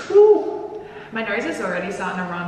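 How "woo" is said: loud high whoop; excited whoop. Real, not acted. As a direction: let out a big woo. Tasting extreme hot sauce.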